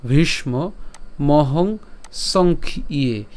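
"ভীষ্ম মহং সংখ্যে" slowly drawn out, sounds stretched; careful pronunciation. Shown as a demonstration. Teacher demonstrating each syllable of the verse slowly. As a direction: spell out "মহং সংখ্যে" slowly, lengthening the final vowels. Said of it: "উচ্চারণটি শিখিয়ে দিচ্ছি".

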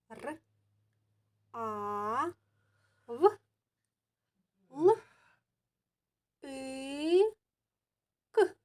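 each sound of "равлик" said separately with pauses; sounding out letters. Shown as a demonstration. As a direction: spell out Р, А, В, Л, І, К one by one. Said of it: "зробіть звуковий аналіз слова равлик". (звуки слова "равлик" окремо, з паузами)

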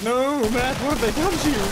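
high, hammed-up dramatic despair; mock anguish. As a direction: cry out like a tragic movie hero.

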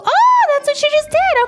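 struck by the realisation; sudden realisation. Real, not acted.

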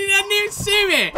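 high-pitched gleeful crowing; gleeful squeal. Real, not acted. Hidden player crowing over missed hunters, gleeful squeal. Gloating while hidden in game.

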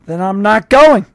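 loud, firm refusal; defiant refusal. A narrator's reading. Score refusing the sergeant, loud and defiant. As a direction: plant your feet and refuse out loud.

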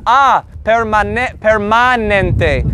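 permanente with open, drawn-out "ah"; dentist "ah". Shown as a demonstration. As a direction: say permanente slowly, opening wide on the A. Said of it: "the A is like at the dentist".

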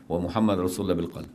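ordinary talk, nothing marked

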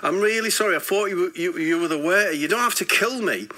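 high, anxious mock begging; flustered, pleading retold apology. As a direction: beg your attacker for mercy, half-acted. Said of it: "I thought he was gonna kill me".

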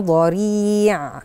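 very slow ضريع with the nasal hum held before the ض; teacher modelling ikhfa slowly; ikhfa ghunna demo. Shown as a demonstration. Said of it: "غنة الإخفاء".

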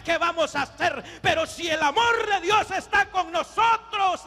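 high, ringing revival preacher; full-force preaching. Real, not acted. Preacher urges the church to trust God's love, full-force preaching. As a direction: proclaim it to the whole congregation.

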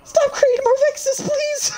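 very high crying shriek; shrieking panic. Real, not acted. Panicked plea to evoker.

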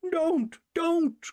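hoher, erschrockener Ausruf; frightened cry. A narrator's reading. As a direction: Eltern rufen dem Sohn ängstlich nach, hoch und flehend. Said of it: "cried his father and mother".

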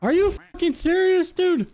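real high-pitched incredulous outburst; exasperated disbelief. Real, not acted. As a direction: protest in disbelief, voice jumping way up. Reacting in disbelief to opponent.

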